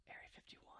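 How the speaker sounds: hushed conspiratorial whisper. Acted. Friend whispering about Area 51 as a secret. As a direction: breathe it like a secret.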